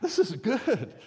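high, laughing "This is good"; laughing contentment. Retold or imitated. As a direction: laugh through it, pleased with life. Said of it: "And I'm like".

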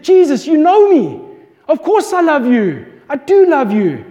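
voix montée, plus forte, insistante; angry insistence. Retold or imitated. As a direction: protester avec colère son amour. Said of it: "He got angry over there".

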